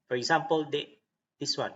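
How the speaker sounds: ordinary delivery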